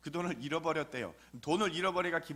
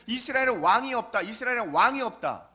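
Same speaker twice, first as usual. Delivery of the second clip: louder, higher ringing refrain; emphatic scripture quote; 5 semitones higher, 7 dB louder than usual. Acted. Preacher booming the Judges refrain at the congregation. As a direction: proclaim the verse like a repeated verdict. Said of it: "계속 그 얘기를 하고 있어요".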